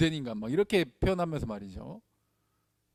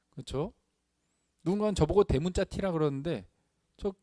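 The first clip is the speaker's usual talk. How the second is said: ordinary talk, nothing marked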